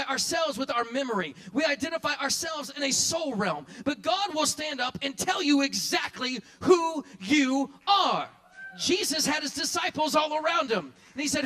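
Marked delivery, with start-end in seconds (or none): 3.9-8.3 loud, slow, building preacher's cry; full-voiced preaching climax; preaching shout. Real, not acted. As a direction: drive it home to the church.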